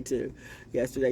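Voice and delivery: normal talking voice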